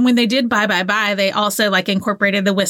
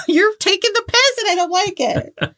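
playful whiny complaint; 10 semitones higher than usual. Real, not acted. Playful scolding, high pitched.

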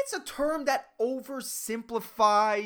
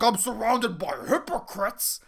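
mock-indignant, over-pronounced complaint; worked up, angry. Student complaining about everyone around her, imitated with over-enunciated outrage. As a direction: imitate an outraged student punching each word. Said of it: "she's like".

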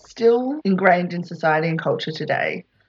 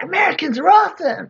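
mock French cheer; 10 semitones higher, 3 dB louder than usual. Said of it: "like, with French accents".

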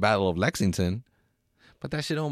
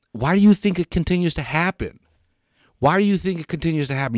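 heated question; 7 semitones higher, 5 dB louder than usual. Real, not acted. Heated rhetorical challenge.

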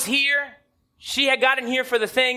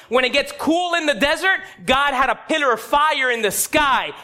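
ordinary talk

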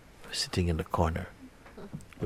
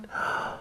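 awed gasp; 4 dB quieter than usual. A narrator's reading. Awe at shimmering light.

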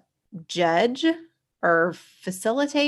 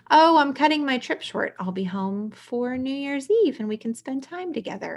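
lightly voiced mom, pitched higher; imitated mom; 6 semitones higher than usual. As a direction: retell it as the mother, high-pitched. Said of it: "it's his mom saying".

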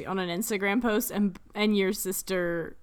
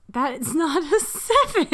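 laughing; 8 semitones higher, 4 dB louder than usual. Real, not acted. Breaks into laughter mid-read.

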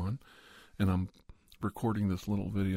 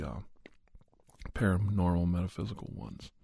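normal talking voice

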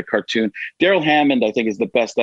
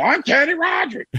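much higher, big cartoon [name]; a cartoonish [name], pitched way up; [name] impression. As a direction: blow it up into a cartoon of the singer. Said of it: "Will Sasso doing [name]".

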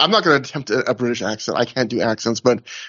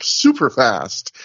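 ordinary delivery

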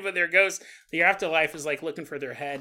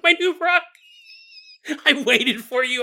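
whiny homeowner; 9 semitones higher, 6 dB louder than usual. Retold or imitated. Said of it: "You're like".

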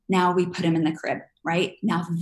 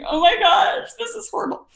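much higher, loud hammed-up alarm; mock panic; 13 semitones higher, 5 dB louder than usual. Panicking parent at the crib door, high mock alarm. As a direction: squeal it like disaster struck. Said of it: "If you're like".

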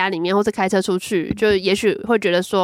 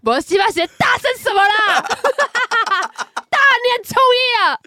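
annoyed yell; 11 semitones higher than usual. Retold or imitated. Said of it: "我想说".

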